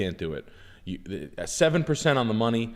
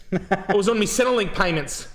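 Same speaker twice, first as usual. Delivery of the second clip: mock jobseeker excuse; 8 semitones higher, 4 dB louder than usual.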